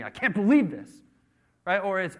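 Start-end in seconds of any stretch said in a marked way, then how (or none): 0.0-0.9 loud, high whine of protest; hammed-up disbelief, pitched up; mock self-pity. Unfaithful husband whose wife is leaving, mock self-pitying protest.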